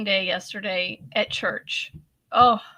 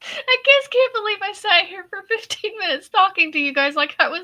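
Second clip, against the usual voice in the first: usual speaking voice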